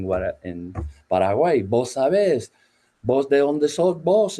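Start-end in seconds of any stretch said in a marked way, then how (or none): 1.6-4.4 Paraguayan voseo. Retold or imitated. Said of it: "no usan el vos como lo usan ustedes ahí en Paraguay".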